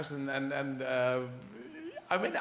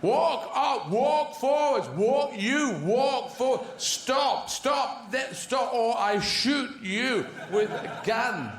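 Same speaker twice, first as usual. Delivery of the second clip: horse shouter; 6 semitones higher, much slower than usual. Retold or imitated. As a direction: yell orders like a rough trainer. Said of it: "horse shouters".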